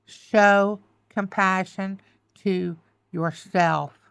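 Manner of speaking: very slow, word by word, leaning on SHOW; slow emphatic plea. Real, not acted. Reader urging the listener to be kind to herself, slow emphatic plea. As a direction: spell it out slowly, each word weighted.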